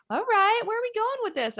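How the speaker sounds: high-pitched mock wariness; acted scepticism. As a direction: pitch it way up, playing suspicious. Acts out her sceptical reaction to emails.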